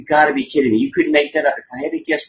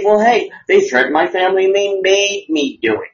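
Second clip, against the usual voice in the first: higher, louder, whiny mock excuse; mock guard's excuse; 7 semitones higher, 4 dB louder than usual. Host mocking the guard's excuse when caught, hammed-up whine. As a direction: ham up a caught guard pleading innocence. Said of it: "he can say".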